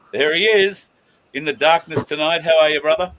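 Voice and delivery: high, loud welcome call; hyped welcome. Real, not acted. Host welcoming his co-host in the dark, high and loud. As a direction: greet him like a game-show host.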